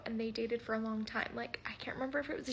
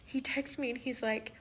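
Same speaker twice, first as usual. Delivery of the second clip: ordinary talk, nothing marked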